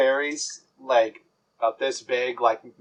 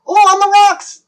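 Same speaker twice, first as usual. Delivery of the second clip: very high, louder delighted squeal; excited fairy yell; 7 dB louder than usual. Acted. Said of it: "he yells".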